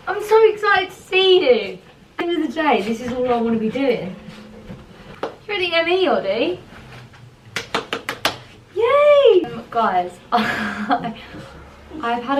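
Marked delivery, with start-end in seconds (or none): 0.1-6.6 excited pet talk. Real, not acted. Greeting her pet excitedly. 8.7-9.4 very high, loud squealed yay; shrieking delighted cheer; excited squeal. Real, not acted. Cheering at pet.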